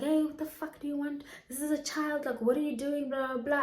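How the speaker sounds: imitating an aunt telling off the caller; retold swearing. Aunt grabs the phone to tell off the creepy caller, mock angry. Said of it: "she starts swearing".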